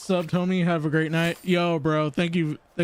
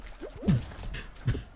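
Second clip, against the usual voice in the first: breathy laugh; 8 dB quieter than usual. Real, not acted. Laughing at own joke.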